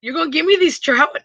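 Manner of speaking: high-pitched re-enacted disbelief; excited. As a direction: act out delighted amazement at a gift. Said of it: "I get excited about simple little stuff".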